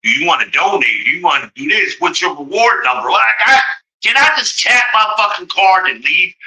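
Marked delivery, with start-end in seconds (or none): 3.1-6.3 exasperated outburst. Retold or imitated. Mocking own frustration at register.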